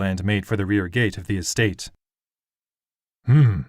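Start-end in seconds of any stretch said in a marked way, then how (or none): 3.2-3.6 short, much louder grunt. A narrator's reading. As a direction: grunt it out loud. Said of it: "Lord Winowed grunted".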